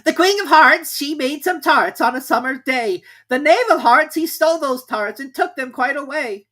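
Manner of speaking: very high, loud herald's proclamation. A narrator's reading. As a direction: announce it like a squeaky herald. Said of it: "read as follows".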